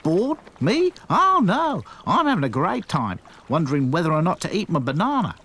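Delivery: high, mock-cheerful sarcasm; bored kangaroo's sarcastic reply. A narrator's reading. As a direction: pretend you're having fun. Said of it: "couldn't resist being sarcastic".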